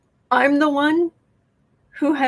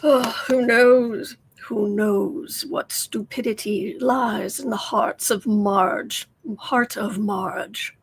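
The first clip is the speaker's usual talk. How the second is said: slow, mock-sinister storyteller voice; ominous narrator; slower than usual. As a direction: drag it out like an old radio villain. Ominous radio-villain parody.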